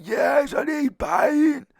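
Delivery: very high, loud, drawn-out mock wail; woman's insistent wail. Woman insisting to the friend, high mock wail. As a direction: put on a shrieky, slow voice insisting.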